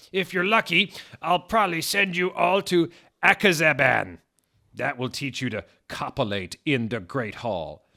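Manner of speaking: put-on high, loud cackling old wizard; cackling Dumbledore. Dumbledore scolding the Satanist students. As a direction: cackle at the kids.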